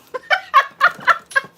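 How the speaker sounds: a loud, high, cackling laugh; shrieking laugh at the chat; loud high cackle. Real, not acted. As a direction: cackle hard at your own joke. Cracking up at own roast.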